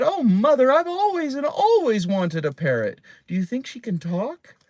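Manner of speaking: put-on high, loud, thrilled child voice; a girl bursting with joy over a parrot; delighted little girl. A narrator's reading. Delighted girl asks about parrot.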